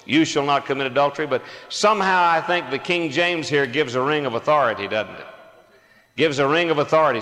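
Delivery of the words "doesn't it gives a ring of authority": normal talking voice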